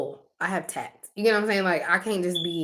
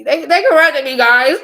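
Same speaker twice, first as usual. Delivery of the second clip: mock outrage; 7 semitones higher, 10 dB louder than usual. Real, not acted. Mock complaint to viewers.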